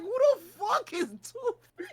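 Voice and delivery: high-pitched mock bafflement through laughter; imitating baffled friend. Retelling friend in his ear at panel, high laughing disbelief. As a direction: play the friend's confusion, high and cracking up. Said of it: "And Chief is like".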